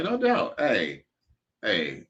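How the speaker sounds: usual speaking voice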